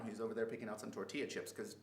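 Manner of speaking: normal talking voice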